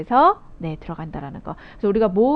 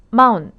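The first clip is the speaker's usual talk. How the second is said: 마운 said much louder; stressed first syllable; 7 dB louder than usual. Shown as a demonstration. Teacher stressing the first syllable of mountain. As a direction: punch 마운 loudly. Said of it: "여기 있죠".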